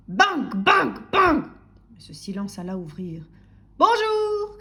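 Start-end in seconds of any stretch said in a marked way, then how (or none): 0.1-1.5 three hard, high bangs; loud knocking. A narrator's reading. Postman hammering on the door, loud bangs. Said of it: "frappait à sa porte". 3.8-4.6 high, loud drawn-out call; shouting postman. A narrator's reading. Postman greeting sleepy householder, shouting. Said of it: "cria le facteur".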